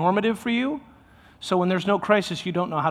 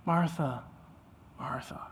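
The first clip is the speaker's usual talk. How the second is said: soft, fading call of her name; tender repeated name; 2 semitones lower, 8 dB quieter, slower than usual. Shown as a demonstration. Jesus gently calling Martha by name, soft and tender. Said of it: "He repeats her name twice".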